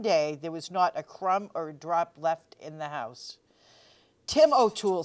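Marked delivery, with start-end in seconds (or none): 4.3-5.0 firm wife calling Tim O'Toole, a bit louder; Kate calling her husband. A narrator's reading. Wife scolding idle husband Tim, firm call. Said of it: "said Kate".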